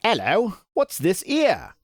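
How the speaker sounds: high, slow, bad Cockney accent. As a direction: ham up a Cockney woman, stretching it out. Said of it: "says in a Cockney accent that I can't do".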